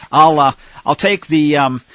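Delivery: ordinary talk, nothing marked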